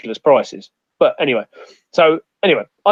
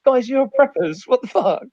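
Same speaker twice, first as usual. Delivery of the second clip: incredulous; 10 semitones higher than usual. Retold or imitated. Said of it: "And I went".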